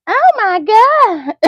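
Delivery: hammed-up gasp of dismay; mock shock. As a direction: stretch it out in pretend horror at the old look. Mock shocked reaction to old look.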